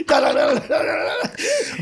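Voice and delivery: mock running noise. Imitating sprinting footsteps.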